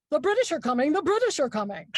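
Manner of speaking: mock town crier. British husband teasing in bed, a touch of a town crier's cry. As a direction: chant it like a colonial alarm. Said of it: "annoying thing every single time".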